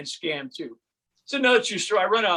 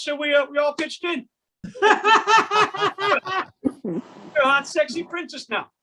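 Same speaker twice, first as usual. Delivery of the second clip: cheery staff; 8 semitones higher than usual. Pretend. Imagined surgical staff with cake.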